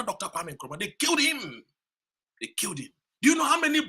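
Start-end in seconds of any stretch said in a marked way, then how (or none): 0.8-1.6 first take, higher and forceful; emphatic declaration. Real, not acted. Speaker telling the crowd Nkrumah was killed, forceful. 2.4-2.9 second take, lower and much softer; low grave echo. Real, not acted. Speaker echoing the killing to the crowd, hushed and grave. Repeat drops to low murmur.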